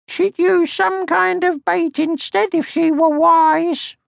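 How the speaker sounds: put-on very high, slow little girl voice, scornful; a tiny high voice, smug and knowing. A narrator's reading. Little My's scornful thoughts.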